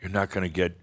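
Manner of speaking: ordinary delivery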